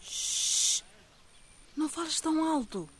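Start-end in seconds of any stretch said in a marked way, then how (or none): 0.0-0.8 long unvoiced shush. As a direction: hush them sharply. Hushing a friend, child asleep.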